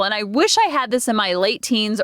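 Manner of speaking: ordinary talk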